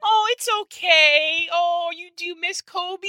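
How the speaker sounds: very high, drawn-out cooing falsetto; mock fussing wife. Husband imitates wife rushing over as he cried, mock gushing falsetto. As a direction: gush slowly in exaggerated, squeaky sympathy.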